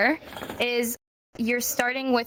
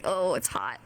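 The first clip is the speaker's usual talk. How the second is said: uncomfortable sigh; 9 semitones lower, 4 dB quieter than usual. Retold or imitated. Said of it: "You're like".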